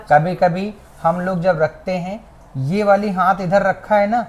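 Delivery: ordinary delivery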